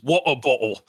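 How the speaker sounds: "water bottle" with Cockney glottal stops; mock British "water bottle", dropping the t's; Cockney accent. Shown as a demonstration. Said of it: "No, that's just Cockney".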